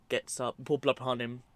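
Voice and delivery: ordinary talk, nothing marked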